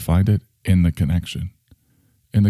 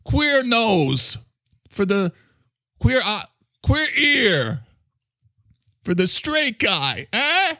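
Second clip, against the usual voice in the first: put-on higher-pitched character with a New York accent; brash New Yorker talking in a raised pitch; Mister Coffee; 12 semitones higher, slower than usual. New Yorker fumbling a TV show's name, high brash voice.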